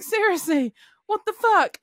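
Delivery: high-pitched exasperated disbelief; retold indignation. Driver left alone in traffic, indignant.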